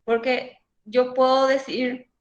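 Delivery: usual speaking voice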